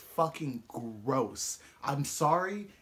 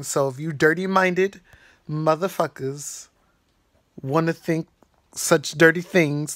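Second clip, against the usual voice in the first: much louder, emphatic telling-off of viewers; loud correction; 12 dB louder than usual. Real, not acted. Scolding dirty-minded viewers, much louder emphatic rant. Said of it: "as I'm editing this part".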